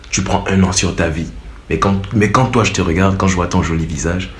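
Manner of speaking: low, softened chat-up voice; subtle sweet-talk. Shown as a demonstration. Suitor flattering an angry girl, subtle and smooth. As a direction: coax gently in a low, measured voice. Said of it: "Soyez un peu subtil, les gars".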